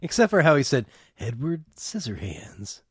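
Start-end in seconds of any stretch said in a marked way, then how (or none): 1.2-2.8 slow, hushed trailer narrator; hopeful trailer announcer, drawn out; movie trailer voice. Retold or imitated. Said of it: "You don't like trailer guy voice in this?".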